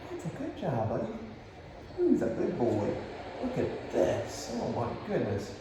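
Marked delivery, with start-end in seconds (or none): 3.4-5.5 slow hushed whisper; whispered aside. Real, not acted. Trainer whispering to viewers beside the dog. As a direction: whisper slowly so the dog isn't disturbed.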